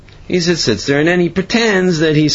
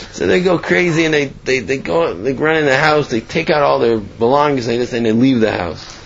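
ordinary talk

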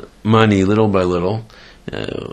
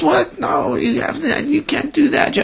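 outraged wife. Retold or imitated. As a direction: refuse him in disbelief, way up high. Wife refuses finder's claim.

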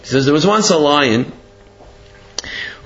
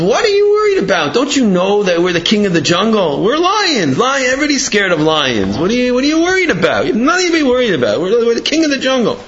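raised pitch, mock-indignant lion father; exasperated pep talk; 6 semitones higher than usual. Lion father to his scared son, exasperated. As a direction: talk big, incredulous at the worry.

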